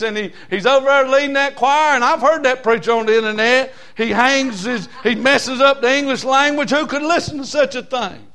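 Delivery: full-force preaching. Acted. Preacher exhorting husbands and wives to please the Lord, full force. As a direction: preach it hard to the pews.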